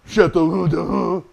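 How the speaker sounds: mocking, dopey drawl on "Chateau"; dumb drawl. French diners mocking a waiter's pronunciation, dumb drawl. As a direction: drag it out like a dolt, mocking. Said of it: "with, like, a dumb drawl on it".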